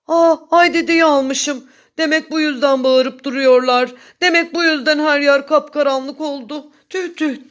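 dismayed whining. A narrator's reading. Bear realising he took the moon, whining in dismay. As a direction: realise it and moan about it. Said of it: "diye sızlandı".

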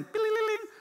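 sing-song ringing phone, jumping way up; playful bing-bing ringtone; phone ring imitation. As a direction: mimic a ringtone chiming.

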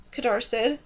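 plain reading voice

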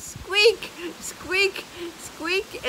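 high squeaky mimic of brakes; squeaky brake noise. Vlogger imitating the golf cart's squeaking brakes, high-pitched.

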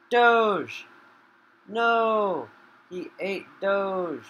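loud, high, drawn-out dismay; shocked shout. Real, not acted. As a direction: yell in disbelief as Doge is eaten. His creature Doge gets eaten.

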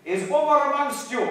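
high, loud shouted question; screamed out. Acted. Enemy leader gets no answer and asks again, screaming out. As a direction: holler it up the mountain. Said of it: "He screamed out".

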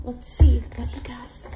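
hushed storyteller whisper. Acted. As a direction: lean in and whisper the suspense. Hushed suspense to children.

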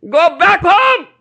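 high, loud, slowed call to go home; quoting guru loudly. Retold or imitated. Preacher quoting his guru's call to devotees, loud and high. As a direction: proclaim it like the guru's summons. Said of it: "My Guru Maharaj says".

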